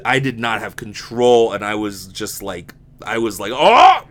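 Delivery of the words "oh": loud, high retold yell; mock scream. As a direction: yelp it out in pain. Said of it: "like, screaming".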